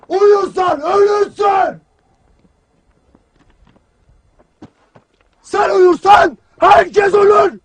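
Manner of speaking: drawn-out, hammering military shout; slow shouted warning. Acted. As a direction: stretch every word as you shout the warning. Commander hammers the warning slowly.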